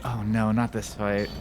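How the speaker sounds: usual speaking voice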